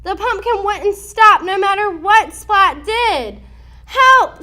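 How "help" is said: loud, high panicked call; cry for help. Acted. Splat chasing the runaway pumpkin, a loud high cry for help. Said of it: "Splat cried".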